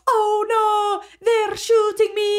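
high, drawn-out mock Irish wail; stupid Irish accent. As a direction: badly imitate an annoying Irish accent. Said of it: "does this fucking stupid ass Irish accent, which I can't do, but he's like".

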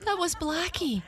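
very high-pitched exclamation; excited cat owner. A narrator's reading.

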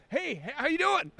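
high, halting hello that trips on itself; awkward stammered hey. Retold or imitated. Starstruck host stammering a hello back to Munoz, awkward. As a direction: fumble the greeting, starstruck. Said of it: "I tried to get a good hey".

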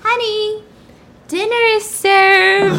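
playing the perfect housewife serving dinner; mock housewife. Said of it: "Just housewife tings".

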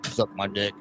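low, quiet mumble; mumbled. Shown as a demonstration. Host as hell character walking by, low mumble. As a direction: mumble it under your breath. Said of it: "like, mumble".

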